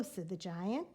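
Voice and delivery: plain reading voice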